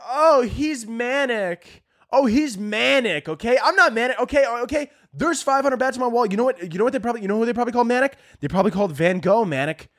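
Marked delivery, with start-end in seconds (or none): 0.1-6.2 higher, whiny mock of online critics; mocking internet critics. Comic mimicking internet critics calling him manic, high and whiny. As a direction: sneer like a smug commenter. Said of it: "they're like".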